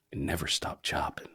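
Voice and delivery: soft breathy whisper to close the show; whispered catchphrase send-off; whispered sign-off. Acted. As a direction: hush it like a secret motto.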